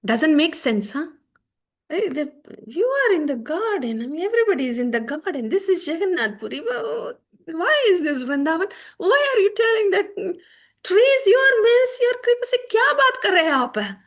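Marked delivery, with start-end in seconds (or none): 1.9-12.5 much higher and louder, near tears, pleading; ecstatic mad talk. Acted. Radharani's mad talk to the trees, high tearful frenzy. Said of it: "Jalpa, mad talk". 12.5-14.0 higher, louder Hindi outburst; mad talk in Hindi. Acted. Radharani demands an answer in Hindi, high ecstatic outcry. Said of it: "Jalpa, mad talk".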